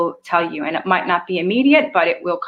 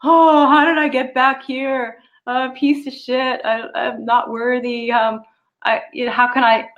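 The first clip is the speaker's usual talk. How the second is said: mock despair; 4 semitones higher than usual. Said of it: "I'd be like".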